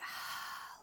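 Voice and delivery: long audible exhale; slow open-mouthed sigh; breathing-out sigh. Shown as a demonstration. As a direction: demonstrate a releasing breath out. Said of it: "And breathe out".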